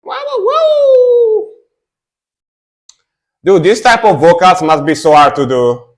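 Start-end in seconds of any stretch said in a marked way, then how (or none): 0.0-1.5 high falsetto wolf howl. Acted. Howls again at the vocals.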